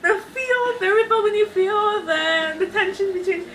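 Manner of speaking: high-pitched fangirl gush; overwhelmed with feels, pitch soaring. Retold or imitated. Reader reliving the tension between two characters, gushing.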